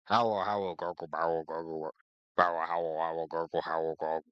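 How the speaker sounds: low, gargled alien howls; howling gurgles. A narrator's reading. The Vogon captain's tannoy heard as low howling gurgles. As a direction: gargle and howl like a beast. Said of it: "This is what he heard".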